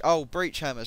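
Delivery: usual speaking voice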